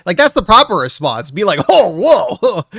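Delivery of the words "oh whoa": loud surprised whoa; mock surprise. Shown as a demonstration. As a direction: react with a big shocked whoa. Proper response demo.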